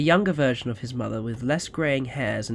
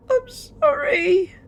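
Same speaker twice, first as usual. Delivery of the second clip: put-on a slow, high sob of a scared boy, much louder; sobbing child; 7 dB louder, much slower than usual. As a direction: weep the apology. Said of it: "sobbed the younger Michael".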